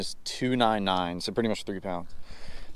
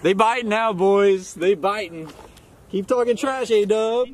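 higher, cocky trash talk; teasing; 8 semitones higher than usual. Real, not acted. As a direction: rub it in playfully. Trash talking his buddy.

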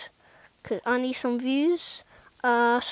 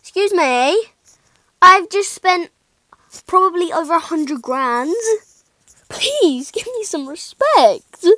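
louder, higher indignant protest; mock outrage; 5 semitones higher, 9 dB louder than usual. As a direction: demand respect with hammed-up outrage. Outraged at imagined hater comments.

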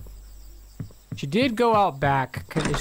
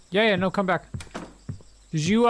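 usual speaking voice

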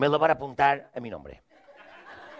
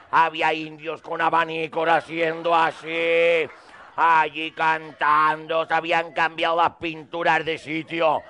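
normal talking voice

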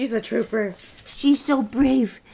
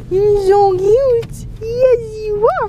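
drawn-out high coo at the puppy; squeaky loving puppy talk; 5 semitones higher, 7 dB louder, slower than usual. Real, not acted. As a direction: gush slowly and loudly over the puppy. Cooing at puppy.

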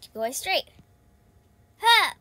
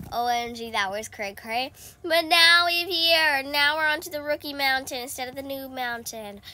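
ordinary talk

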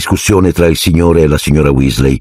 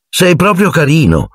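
higher sarcastic retort; irritated; 12 semitones higher than usual. Acted. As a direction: snap back sarcastically. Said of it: "ribatté Ginny irritata".

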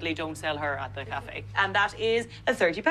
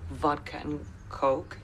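slow, lower prompt to repeat; teacher modelling; 4 semitones lower, 3 dB quieter, slower than usual. Shown as a demonstration. Teacher feeds student the next words, slow and measured. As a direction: dictate it slowly for the learner. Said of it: "I'm the teacher here".

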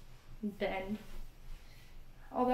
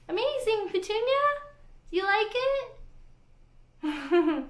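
very high, doting exclamation; pet-talk voice; 11 semitones higher, 3 dB louder than usual. Real, not acted. Vlogger praising and questioning Petunia, high pet-talk voice. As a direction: squeal fondly to a pet, pitched way up.

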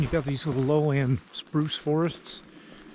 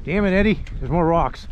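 exasperated; 4 semitones higher, 7 dB louder than usual. Real, not acted. Hiker fed up with rocks.

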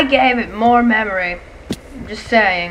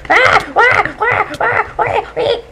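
high, loud wah cries; panicked wailing; 12 semitones higher, 5 dB louder, much slower than usual. Real, not acted. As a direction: wail in sudden panic. Panicked in a game attack.

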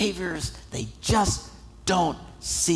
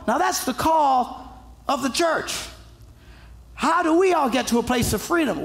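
ordinary talk

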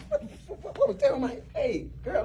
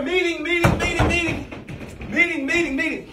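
high sing-song call to gather everyone; 9 semitones higher than usual. Acted. New boss calling the staff to a surprise meeting, high sing-song call. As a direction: holler it across the office.

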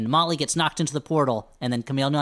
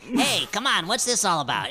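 put-on alien voice, starting high; comic alien voice; 5 semitones higher than usual. Host showing how aliens sound, comic alien voice. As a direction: do a jokey alien, jumping up in pitch then settling. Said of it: "they all sound like that".

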